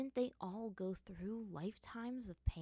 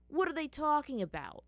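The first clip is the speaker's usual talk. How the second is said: higher and louder demanding challenge; rising aggression; 7 semitones higher, 7 dB louder than usual. Acted. Sokka demanding answers about secrets, aggressive. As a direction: push hard, voice climbing. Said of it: "his voice rising aggressively".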